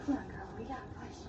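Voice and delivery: soft, hushed coaxing to a cow; soothing. Real, not acted.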